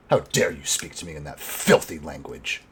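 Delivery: low, quiet indignation with contempt; angry captive priestess. Acted. Elf priestess answering her interrogator in Common, quietly angry. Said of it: "she glares at you angrily".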